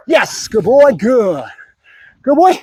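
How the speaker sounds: high-pitched reward for a dog; excited praise. Real, not acted. Trainer rewards Bruno for barking, excited praise. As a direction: lift the voice into happy approval.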